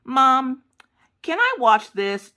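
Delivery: put-on high, drawn-out kid voice; pestering child. Daughter keeps coming down to ask mom, high pestering whine. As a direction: imitate a child calling for mom. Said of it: "she would come down, like, every ten minutes".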